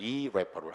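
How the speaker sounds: irreparable said as ee-REP-uh-ruh-bl, stress on the second syllable; pronunciation demo. Shown as a demonstration. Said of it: "발음할 때 irreparable". (irreparable 발음 시범, 둘째 음절에 강세)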